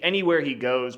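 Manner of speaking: ordinary talk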